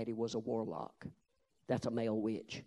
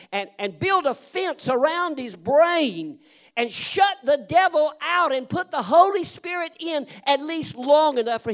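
fervent loud prayer; 12 semitones higher, 12 dB louder than usual. Acted. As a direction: pray over him with force. Retelling the prayer with force.

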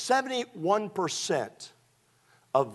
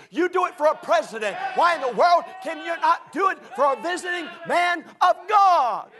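higher and louder preacher, shouting to the climax; preaching at full force; 11 semitones higher, 5 dB louder than usual. Acted. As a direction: demand it of the church at full voice.

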